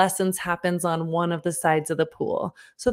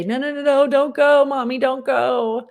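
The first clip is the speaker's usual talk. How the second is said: put-on high, pleading little-kid wail, a bit louder; imitating a crying toddler; 6 semitones higher, 4 dB louder than usual. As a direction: beg like a child clinging to his mom. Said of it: "clawing at me to say".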